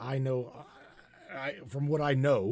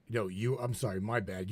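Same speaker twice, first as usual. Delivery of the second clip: ordinary talk, nothing marked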